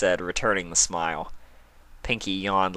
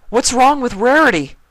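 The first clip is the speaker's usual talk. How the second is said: much higher and louder, urgent; panicked; 10 dB louder than usual. A narrator's reading. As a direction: demand the truth in fear. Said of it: "he asked in a panic".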